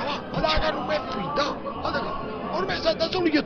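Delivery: usual speaking voice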